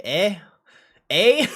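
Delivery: high, loud Canadian eh, hammed up; exaggerated Canadian eh; mock Canadian accent. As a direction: play a cartoon Canadian, eh. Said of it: "I can't do a Canadian accent".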